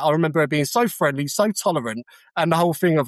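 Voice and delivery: usual speaking voice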